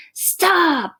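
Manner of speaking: a curt command to stop; sharp command. Shown as a demonstration. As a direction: bark the order short. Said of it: "when you are giving a direct command".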